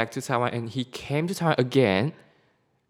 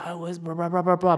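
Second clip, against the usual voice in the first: higher-pitched mock gibberish of an excited friend; imitating excited babble; 6 semitones higher, faster than usual. Friend excited to be back in Taiwan, imitated excited babble. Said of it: "he's really excited to back to Taiwan".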